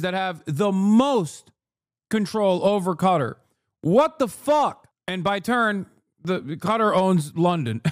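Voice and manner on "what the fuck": high, slow exasperated outburst; outraged disbelief. Real, not acted. Streamer appalled at claim about Qatar, outraged disbelief. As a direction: draw it out, pitched up and appalled.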